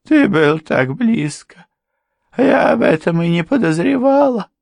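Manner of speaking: high, loud acted lament; tearful wife. A narrator's reading. Wife learns her husband was near, high tearful cry. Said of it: "заплакала его жена".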